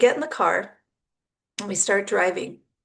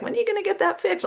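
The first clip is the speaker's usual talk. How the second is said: much higher and fast, teasing; teasing friend; 11 semitones higher, much faster than usual. Retold or imitated. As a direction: poke fun like a laughing friend. Friend Amelia teasing, retold.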